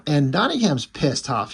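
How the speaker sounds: ordinary talk, nothing marked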